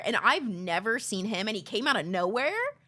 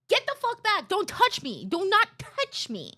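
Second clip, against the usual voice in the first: higher, sharp warning shout; mock angry yell; 10 semitones higher, 3 dB louder than usual. Yelling at a stranger who touched her, angry. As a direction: bark them back off.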